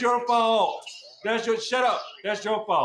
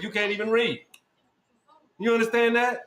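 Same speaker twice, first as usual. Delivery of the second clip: ordinary talk, nothing marked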